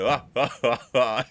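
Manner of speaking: short burst of mock laughter; imitated silly laugh. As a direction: copy the coworker's fake laugh. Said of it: "she was just going".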